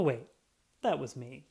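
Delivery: ordinary delivery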